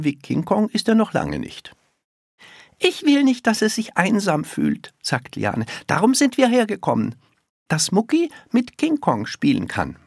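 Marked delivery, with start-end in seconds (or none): 2.8-4.9 put-on high-pitched child explaining; high, insistent girlish voice; little girl. A narrator's reading. Little girl explains her visit. 5.9-10.0 put-on high child's voice, explaining; pitched-up small girl; little girl. A narrator's reading. Little girl explains her visit.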